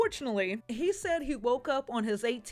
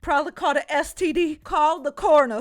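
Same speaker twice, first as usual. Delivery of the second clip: mock dramatic outcry; 2 semitones higher, 7 dB louder than usual. Mocking Dee Dee's overreaction.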